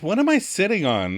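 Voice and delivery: mild whine, pitched a little up; a touch of whining complaint; Rachel. Retold or imitated. Rachel to Chandler on finding something in the seat, mock whine. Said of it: "And Rachel whines".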